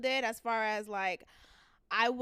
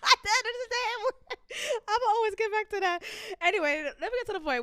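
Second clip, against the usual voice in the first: high, loud and fast through laughter; laughing; 10 semitones higher, 12 dB louder, faster than usual. Real, not acted. Host laughing, then hurrying on. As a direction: talk while cracking up.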